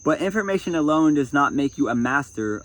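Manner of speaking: projected reading from a self-help book; book reading aloud. Acted. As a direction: read it out firmly to an audience.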